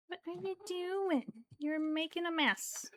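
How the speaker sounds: high, cooing reproach to a cat; playful scolding. Real, not acted. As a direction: scold a pet in a high lilt. Cooed scolding of messy cat.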